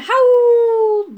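storyteller howling the word; howled word. A narrator's reading. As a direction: draw HOWLED out into a loud, high wolf howl. Howl acted on the word.

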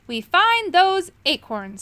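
firm resolve. A narrator's reading. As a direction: settle it firmly. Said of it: "said Moongobble firmly".

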